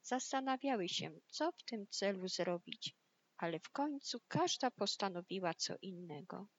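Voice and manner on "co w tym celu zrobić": plain reading voice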